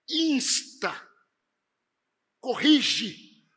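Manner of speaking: forceful commands, each word set apart by pauses; Paulo, emphatic. Acted. Preacher voicing Paul's commands to Timothy, emphatic and forceful. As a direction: hit each imperative hard and let it stand alone. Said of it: "ele é tão enfático com Timóteo e ele diz assim".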